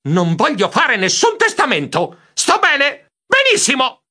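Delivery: high yells, climbing phrase by phrase; shrieking protest. A narrator's reading. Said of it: "Io strillai". (strilli esasperati)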